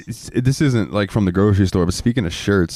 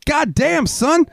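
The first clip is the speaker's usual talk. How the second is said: much higher, hammed-up amazed exclamation; much slower than usual. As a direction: react like you can't believe what you just saw. Imitating manager's amazed reaction.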